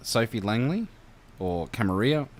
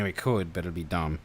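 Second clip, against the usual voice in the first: ordinary talk, nothing marked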